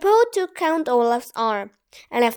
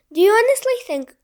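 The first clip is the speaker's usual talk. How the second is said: quick, higher, louder exasperation; exasperated; 8 semitones higher, 6 dB louder, faster than usual. A narrator's reading. Banker scolding the villain, exasperated and fast. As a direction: fire it off, fed up. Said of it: "exasperated voice".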